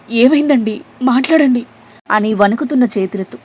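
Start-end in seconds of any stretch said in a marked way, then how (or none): 0.1-1.7 higher, louder tearful plea; a touch of sobbing as she begs him to speak; crying in shock. A narrator's reading. Wife weeping over her collapsed husband, pleading. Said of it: "దిగ్భ్రాంతితో ఏడుస్తూ".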